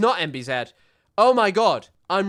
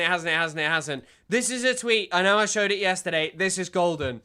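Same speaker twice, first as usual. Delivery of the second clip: normal talking voice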